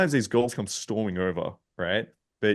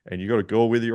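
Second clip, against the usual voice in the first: normal talking voice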